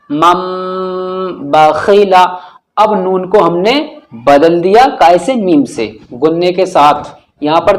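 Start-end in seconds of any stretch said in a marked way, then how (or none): 0.1-2.2 "मम" with a long nasal hum on the final m; ghunna demonstration. Shown as a demonstration. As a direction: hold the m of "मम", then say "बखीला". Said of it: "कैसे पढ़ेंगे?". ("मम" का मीम गुन्ने के साथ खींचकर)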